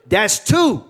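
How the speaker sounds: mildly hammed-up angry count; mad. Mad bride warns the horse a second time, mock threat. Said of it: "she got mad".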